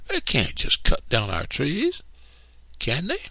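high, pleading little voice; anxious child. A narrator's reading. Cassie worries to Stacy about their trees, high and anxious. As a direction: fret upward into a worried question. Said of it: "I asked anxiously".

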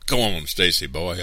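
usual speaking voice

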